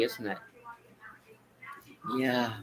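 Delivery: ordinary talk, nothing marked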